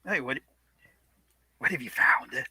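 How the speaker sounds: airy hissing whisper like a snake. Acted. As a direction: hiss the words out, like a small sizzling fire. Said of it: "more, more hissing voice".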